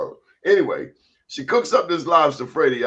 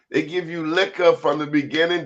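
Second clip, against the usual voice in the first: normal talking voice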